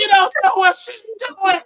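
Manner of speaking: mock hysterical screech; high-pitched, imitating a frantic patient; screaming. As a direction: shriek it as if in agony. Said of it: "She's screaming".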